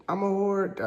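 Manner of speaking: high, small mock-tearful voice; forced confession. Girlfriend forced to repeat it to her dad, high and broken. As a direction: say it as if coerced and crying.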